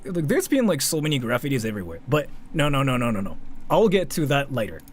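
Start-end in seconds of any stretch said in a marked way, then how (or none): none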